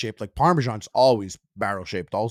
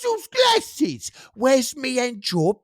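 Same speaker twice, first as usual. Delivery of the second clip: put-on high, slow shouted cockney accent; mock British accent; 13 semitones higher, 5 dB louder, slower than usual. Calling out to Muscle Glasses in a shouted mock British accent. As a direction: bellow it like a cartoon British geezer. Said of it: "Me, if I was British, I would be like".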